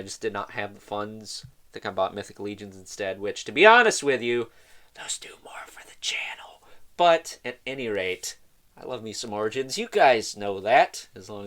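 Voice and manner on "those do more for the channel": unvoiced conspiratorial whisper; whispered aside. Real, not acted. Whispered aside about channel.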